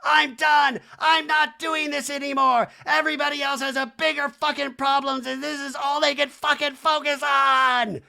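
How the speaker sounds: high, loud hammed-up tirade; mock furious shout. Kyle storming out at everybody, mock shouting. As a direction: shout it like a dramatic exit. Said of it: "one more time to shout at everybody".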